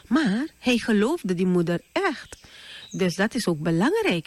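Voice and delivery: ordinary talk, nothing marked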